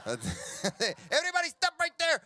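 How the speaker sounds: slow, high shouted order; border guard command. Acted. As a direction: play a guard halting a crowd.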